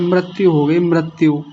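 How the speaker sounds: मृत्यु as mrat-yu; common mispronunciation. Retold or imitated. Teacher quoting society's error, mrat-yu for मृत्यु. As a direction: say मृत्यु with an a after r. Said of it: "बहुत गलत उच्चारण किया जाता है".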